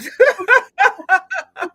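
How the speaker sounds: loud, high burst of laughter; big laugh. Real, not acted. Host laughing while watching the time, big laugh.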